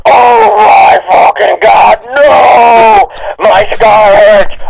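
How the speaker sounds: slow, crying mock scream of pain; hammed-up scream. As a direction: wail it like a melodramatic hero. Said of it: "he started to scream".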